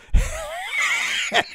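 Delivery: very high burst of laughter; high squealing laugh. Real, not acted. Host amused by the phone story, high squealing laugh.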